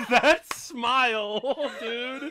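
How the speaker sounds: high, loud, laughing words; slow, helpless laughing exclamation; real laughter. Real, not acted. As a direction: crack up while drawing the words out. Cracking up over the film.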